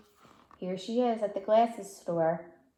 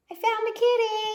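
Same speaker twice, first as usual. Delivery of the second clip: high, fast, sing-song kitty; excited child; 5 dB louder, faster than usual. Acted. Little girl showing her parents her find, excited sing-song. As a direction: chirp it out like a delighted little girl.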